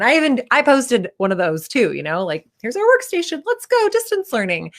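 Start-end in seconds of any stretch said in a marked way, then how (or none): 2.6-4.7 much higher, sing-song fake enthusiasm; mock cheery post. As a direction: parody a perfect Instagram mom. Mocking cheery social media post.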